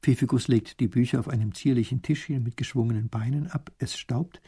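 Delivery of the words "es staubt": usual speaking voice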